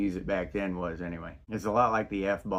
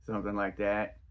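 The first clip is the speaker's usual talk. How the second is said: ordinary talk, nothing marked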